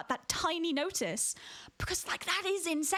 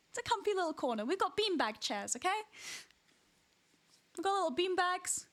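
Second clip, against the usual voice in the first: cozy welcome; 5 semitones higher than usual. Acted.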